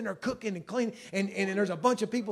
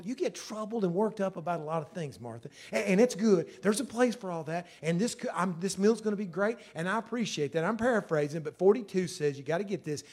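usual speaking voice

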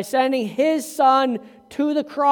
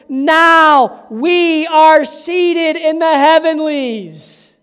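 loud, higher, slow triumphant preaching; full-force preaching; 4 semitones higher, 7 dB louder, slower than usual. Acted. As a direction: proclaim it to the back row.

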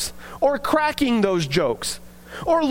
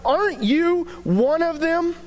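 acusação; 6 semitones higher than usual. Retold or imitated. Voicing accuser questioning Peter.

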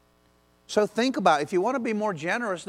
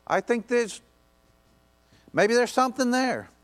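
passionate preaching; 6 semitones higher than usual. Acted. Preaches at full force, pitch up.

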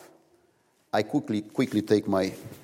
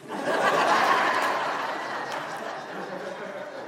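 laughing; 7 semitones higher than usual. Real, not acted. Laugh after the punchline.

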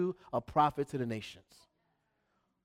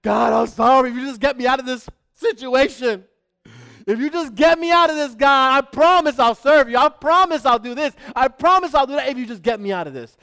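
loud, high desperate pleading; begging for my life; 6 semitones higher, 9 dB louder than usual. Acted. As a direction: beg God to get you out. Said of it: "How many of us been there?".

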